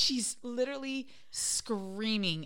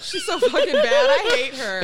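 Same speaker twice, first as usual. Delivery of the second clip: high, loud laughing complaint; laughing exasperation; 9 semitones higher, 10 dB louder than usual. Real, not acted. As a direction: laugh it out in mock despair. Laughing mock complaint about cat.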